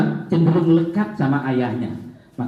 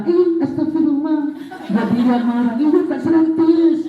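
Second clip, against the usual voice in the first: mock surprised show-off; 10 semitones higher than usual. Pupil fakes a fall, shows 100.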